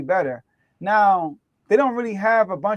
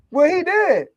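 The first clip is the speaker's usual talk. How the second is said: mock fan retort; 11 semitones higher, 5 dB louder than usual. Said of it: "this other person might be like".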